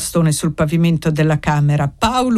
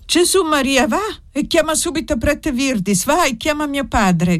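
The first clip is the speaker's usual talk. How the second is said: alarmed cry; 9 semitones higher than usual. A narrator's reading. Widow rushing to open the door, alarmed cry. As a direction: cry out urgent orders, pitch held high. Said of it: "gridò la vedova correndo ad aprire".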